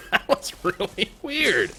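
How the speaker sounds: very high unvoiced giggle then slow amazed words; high giggly disbelief; breathy high giggle. Real, not acted. After a strange win.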